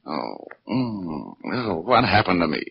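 mock dazed moans, slow and pained; faked groggy waking. Detective pretends to wake from the blow, groggy moan. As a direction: play possum coming to, groaning. Detective faking coming round.

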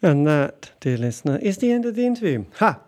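loud, hammed-up announcer outro; host sign-off. Host addressing the dear listener after the interview, booming announcer voice. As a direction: boom it out to the listeners with a flourish.

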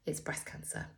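quiet bad news. Surgeon delivering diagnosis.

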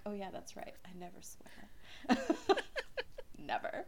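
soft, slow sarcastic whisper; mother joking she never swears; mock innocent. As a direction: deny it with a wink, slowly. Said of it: "And I'm like".

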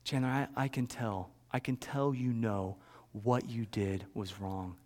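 soft, low retold dad voice; hushed and measured, lightly acted parent; gentle fatherly talk. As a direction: quietly acknowledge a child's remorse. Father gently reassures guilty son.

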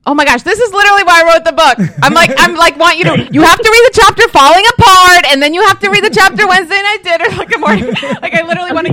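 loud, high, insistent excitement; urgent enthusiasm. Real, not acted. Author urging caller to read the chapters, excited. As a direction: excitedly press someone to do it.

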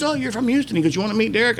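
a hint of an eager manager; imitating the manager. Manager invites fellow Houstonian in, lightly imitated friendly voice.

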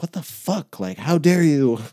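reliving the moment he snapped at someone, high and heated; retold angry outburst. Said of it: "I was like".

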